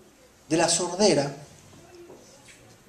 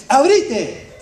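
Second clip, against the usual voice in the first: retold shout; 5 semitones higher, 3 dB louder than usual. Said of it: "pega el grito y dice".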